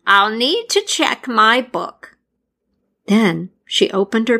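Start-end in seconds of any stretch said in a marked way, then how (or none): 0.1-2.2 high, slow character voice; Pesta voice. A narrator's reading. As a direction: ponder it slowly up high. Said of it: "Pesta replied".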